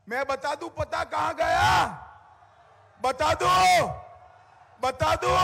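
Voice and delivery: loud, high crowd-rousing delivery; booming political rally speech. Real, not acted. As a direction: whip up the crowd. Rally speech at full force.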